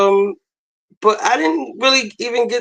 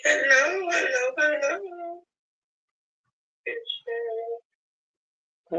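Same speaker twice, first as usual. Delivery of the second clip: softer, slow hello, then a high, thin good show; soft high repeat; 9 semitones higher, 10 dB quieter, much slower than usual. Pretend. Vlogger repeating her greeting softly with a high falsetto tag. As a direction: echo the greeting softly and float the end up high.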